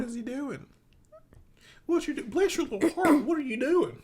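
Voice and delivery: high, mock Southern mom voice; teasing high-pitched Southern lady. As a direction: coo in a high Southern twang. Mimicking her Southern mom talk.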